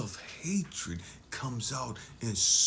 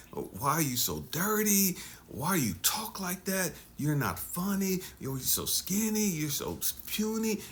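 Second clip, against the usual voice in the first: rising, exasperated scolding parent voice, higher and higher; nagging parent; 4 semitones higher than usual. Retold or imitated. Parent berating the little child at home, exasperated higher scolding. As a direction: scold the child like a fed-up parent, climbing in pitch. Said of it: "and it's like".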